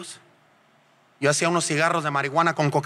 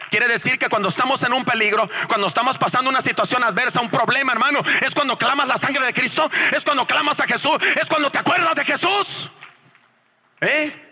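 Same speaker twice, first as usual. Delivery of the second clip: rising into full preacher mode, climbing higher; preaching; 8 semitones higher than usual. Acted. Preacher urging the congregation to call on Jesus, rising fervor. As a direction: build the sermon up toward Jesus.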